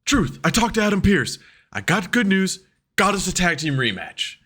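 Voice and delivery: high-pitched excited wrestler bit; excited good news. Acted. Wrestler telling Truth he got a rematch, excited. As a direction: burst out with the big news.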